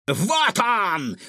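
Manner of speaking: high, triumphant villain cry; triumphant yell. A narrator's reading. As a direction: yell at the find. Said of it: "завопил Финдершлоц". (Финдершлоц торжествующе вопит)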